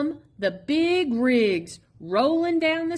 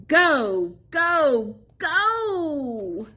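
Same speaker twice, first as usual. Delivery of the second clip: building cheer of go; 5 semitones higher, much slower than usual. Acted. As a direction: cheer him on from the sidelines. Cheering on Little E.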